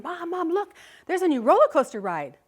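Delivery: put-on high, eager kid voice tugging at mom; excited child. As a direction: play the excited little boy begging. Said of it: "my son is tugging at me, saying".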